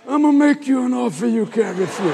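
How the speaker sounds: a hint of a mafia boss, half laughing; Godfather line. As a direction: play it as a lightly voiced gangster offer. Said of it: "I could hear this".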